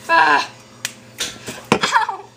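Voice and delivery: sudden pained yelp; stubbed toe. Real, not acted. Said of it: "I just stubbed my toe".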